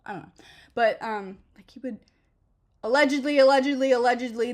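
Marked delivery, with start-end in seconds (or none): 2.8-4.5 allegedly punched and repeated, louder each time. Acted. Joking cover about the manager's drug habit, emphatic allegedly. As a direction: lean hard on allegedly. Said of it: "this never happened".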